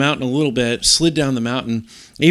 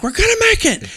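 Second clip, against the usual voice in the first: retold yelling; faster than usual. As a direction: yell reassurance to the kids in the storm. Yelling to kids in blizzard.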